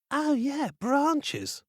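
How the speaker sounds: high, sly realization; a spoiled girl's bright idea; bratty eureka. Acted.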